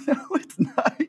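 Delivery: high, giggling repeat of the line; laughing. Real, not acted. Echoing her friend's flub, laughing. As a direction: laugh it out in disbelief.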